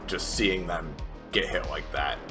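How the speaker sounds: normal talking voice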